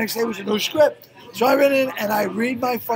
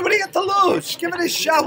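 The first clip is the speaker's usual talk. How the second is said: mock pleading yell; 9 semitones higher than usual. Retelling his plea to elderly audience.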